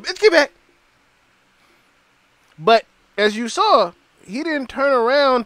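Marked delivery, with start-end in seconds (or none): 0.0-0.5 mock shove-off.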